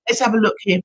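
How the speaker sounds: usual speaking voice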